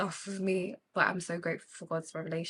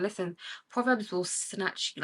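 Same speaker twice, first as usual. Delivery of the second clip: usual speaking voice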